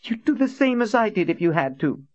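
put-on high, indignant young woman; angry Nadine. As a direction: fire it back at her, high and hurt. Nadine's voice again.

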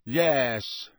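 mildly sullen yes; drawn-out, reluctant admission; resentful. Acted. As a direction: answer grudgingly. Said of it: "said Malfoy resentfully".

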